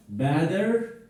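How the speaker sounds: normal talking voice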